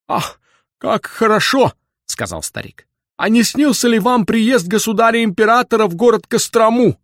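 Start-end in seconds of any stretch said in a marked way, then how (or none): 0.1-1.7 high, rapturous exclamation; delighted old man. A narrator's reading. Old man thrilled by Bender's dream, high rapture. 3.2-7.0 high, loud, excited questioning; eager old man. A narrator's reading. Old man asks about the emperor, high eager voice.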